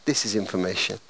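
a new voice answering the line; a lightly voiced stranger operator; different operator. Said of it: "And a different voice said".

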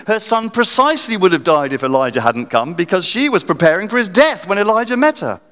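ordinary talk, nothing marked

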